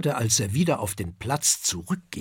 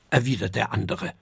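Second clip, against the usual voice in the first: ordinary delivery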